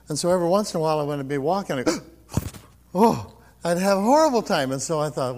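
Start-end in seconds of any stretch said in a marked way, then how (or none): 2.9-3.3 sudden gasp and groan, acted out; pained gasp. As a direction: gasp as if pain just caught you. Acts out chest pain while walking.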